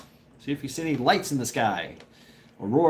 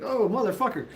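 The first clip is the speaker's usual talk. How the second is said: mock alarm, voice pitched up; retold dismay; 9 semitones higher than usual. Recalling a goose over his head, mock alarm. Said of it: "I was like".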